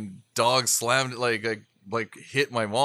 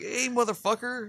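mock yelp; 12 semitones higher than usual. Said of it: "I'm like".

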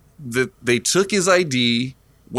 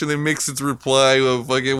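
ordinary talk, nothing marked

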